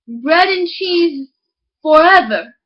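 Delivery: slow, then loud on forever; hearty exclamation. Acted. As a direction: proclaim it cheerfully. Miller's hearty reply.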